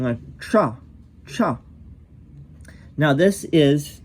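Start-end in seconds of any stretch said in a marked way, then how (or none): 0.4-1.6 cha said from the palate; palatal cha. Shown as a demonstration. Teacher demonstrating the palatal cha to students. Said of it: "you can hear how I'm saying".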